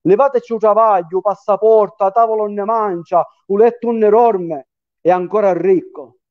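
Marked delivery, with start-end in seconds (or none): none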